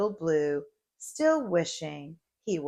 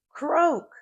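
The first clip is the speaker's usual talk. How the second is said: toad croak; 9 semitones higher, 4 dB louder than usual. A narrator's reading. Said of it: "said Toad".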